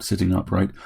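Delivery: ordinary delivery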